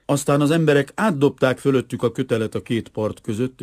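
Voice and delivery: ordinary delivery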